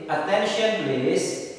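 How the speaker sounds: teacher's slow drawn-out demo. Shown as a demonstration. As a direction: model "Attention please" slowly for the class. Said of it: "ekspresinya seperti ini".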